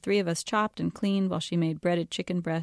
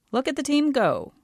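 higher, louder cheerful greeting; jovial dad; 8 semitones higher, 5 dB louder than usual. A narrator's reading. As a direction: cheer the family on from the doorway. Jovial dad greets busy family.

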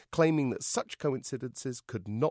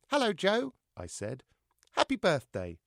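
high puppet voice; cheeky puppet; 8 semitones higher than usual. A narrator's reading. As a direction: greet him cheekily. Puppet George speaks.

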